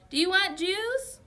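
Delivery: high, slow, loud call; screaming. A narrator's reading. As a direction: shout the question over the racket. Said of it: "Mom screamed".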